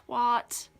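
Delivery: "twat" said as "twot", with an O; wrong pronunciation. Shown as a demonstration. Cringing at saying it wrong, "twat" as "twot". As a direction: say "twat" slowly, rhyming with "hot". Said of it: "for saying it wrong".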